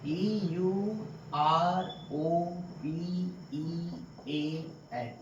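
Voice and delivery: slow letter-by-letter spelling. Shown as a demonstration. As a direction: spell E U R O P E A N one letter at a time. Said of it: "इसे आप समझने का प्रयास कीजिएगा".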